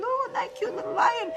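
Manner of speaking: put-on high baby-talk coo; cooing at cute lion. Imagined people admiring a lion, high cooing. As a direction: coo like at a kitten.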